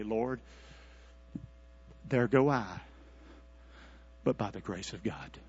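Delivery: low, soft, with reverent pauses; humble prayer. Acted. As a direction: pray it quietly, phrase by phrase.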